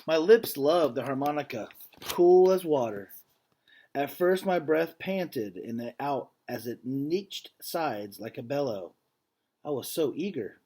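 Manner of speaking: earnest, measured read-aloud voice; story narration. A narrator's reading. Teacher reading a picture book to students, earnest storytelling. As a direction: read the story to the class with feeling.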